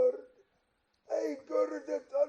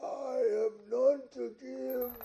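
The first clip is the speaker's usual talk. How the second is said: normal talking voice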